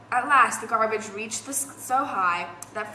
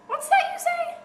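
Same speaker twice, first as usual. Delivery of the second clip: surprised question; 3 dB louder than usual. Acted. Peggy hears it's Saturday.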